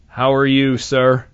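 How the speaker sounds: normal talking voice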